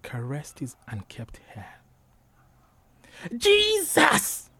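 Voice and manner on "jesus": very high, loud cry; startled cry. A narrator's reading. Preacher boy crying out as Aminat caresses him, loud high cry. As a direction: cry out loudly at a high pitch. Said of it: "Kihende cried".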